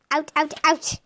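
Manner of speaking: high, loud kit squeak. A narrator's reading. Kit chasing the pretend fox, high loud squeaks. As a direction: squeak it out excitedly. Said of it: "Nut Kit squeaked".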